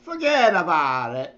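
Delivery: a light New Jersey mobster accent on forget about it; hint of a New Jersey Italian mobster; Jersey mob accent. Shown as a demonstration. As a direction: drop in the catchphrase in a mild Jersey mob accent. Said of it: "throw in the".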